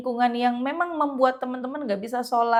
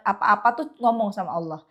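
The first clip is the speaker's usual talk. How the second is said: ordinary talk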